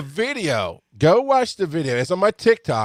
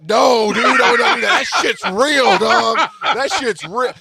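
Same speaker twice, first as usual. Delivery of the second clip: laughing reassurance; 8 semitones higher, 5 dB louder, slower than usual. Retold or imitated.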